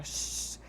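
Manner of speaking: soft unvoiced shhh like rain; rain shush. Shown as a demonstration. Speech therapist imitating rain for a child, soft shush. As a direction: hiss softly like falling rain. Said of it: "sons da natureza".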